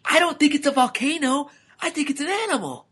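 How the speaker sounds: put-on very high young lizard voice; high, excited kid lizard; urgent calling out. A narrator's reading. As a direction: shout the discovery up to your dad. Lizard son calling to his dad.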